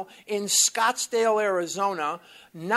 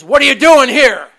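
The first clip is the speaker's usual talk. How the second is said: loud, higher demand; retold loud demand; 5 semitones higher, 16 dB louder than usual. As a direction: challenge him at the door. Sponsor blocking the door, retold.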